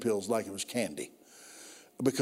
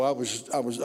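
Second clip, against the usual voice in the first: normal talking voice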